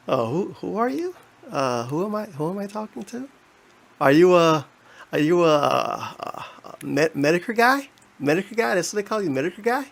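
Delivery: lightly mimicking a puzzled streamer; feigned confusion. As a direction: play dumb, asking who this is. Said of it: "That's one of his gimmicks".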